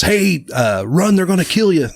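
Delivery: retold urgent warning. Retelling a film character warning someone on the cliff, mock urgent call. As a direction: imitate calling out a warning.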